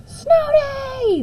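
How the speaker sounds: high, thrilled cheer; children cheering for a snow day; excited calling out. A narrator's reading. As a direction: shout it like kids hoping for no school. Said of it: "the children all called out".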